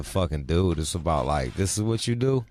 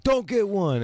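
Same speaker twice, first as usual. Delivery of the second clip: mock dad warning; 12 semitones higher than usual. Hypothetical stern dad line.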